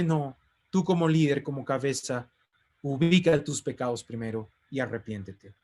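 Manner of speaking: normal talking voice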